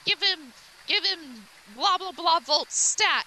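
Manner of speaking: lightly acted urgent doctor; doctor's order. Doctor orders shock in retelling.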